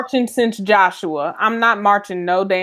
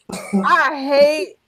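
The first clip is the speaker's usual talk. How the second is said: drawn-out exasperation; 4 semitones higher, much slower than usual. Real, not acted. Friend exasperated after joke.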